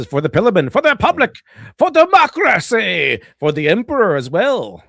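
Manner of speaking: high, loud mock proclamation; hammed-up rallying cry. Streamer reading a super chat slogan, hammed-up rallying cry. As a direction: declaim it like a mock battle cry.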